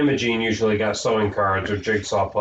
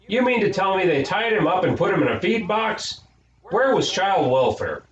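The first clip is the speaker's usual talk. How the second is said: indignant; 10 semitones higher than usual. A narrator's reading. Imogene indignant about swaddling.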